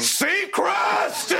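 a high, gleeful shout of Seacrest. Pretend. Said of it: "I feel like I shout that several times".

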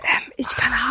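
slow unvoiced whisper; ASMR whisper. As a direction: hush it like a secret. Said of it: "du darfst da nicht so laut reden". (ASMR-Flüstern)

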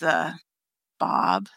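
deep, flat grunt of a stranger; low mumbled reply. Retold or imitated. A stranger answering Connie, a low mumbled name. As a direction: mumble it low like a man who won't talk. Voicing the mumbling homeless man.

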